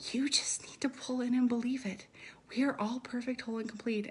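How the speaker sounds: hushed whisper. Real, not acted. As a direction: whisper it softly, close in. Spoken affirmation, suddenly hushed.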